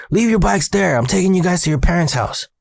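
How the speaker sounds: high-pitched urgent orders; retold freaking out. Maintenance man ordering boys into golf cart, freaking out. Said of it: "Rudy's freaking out".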